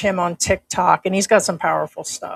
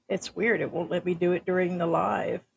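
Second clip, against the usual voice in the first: normal talking voice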